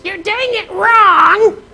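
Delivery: very high, slow scolding wife voice; nagging wife. Retold or imitated. Wife scolding husband over crown molding, high nagging. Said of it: "your wife says".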